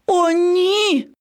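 high, dismayed gasp of words; startled lion cub; recoiling in alarm. A narrator's reading. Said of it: "Левеня відсахнулося".

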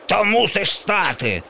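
high, far zombie yell; bellowing zombie. A narrator's reading. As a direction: bellow the demand like a tyrant monster. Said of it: "волав зомбі".

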